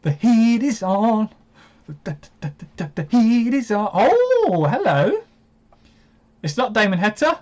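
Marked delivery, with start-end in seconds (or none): 0.0-5.2 a mock showman, lightly voiced; funny voice. Real, not acted. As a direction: put on a silly excited announcer voice. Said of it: "I don't know why I'm talking like that".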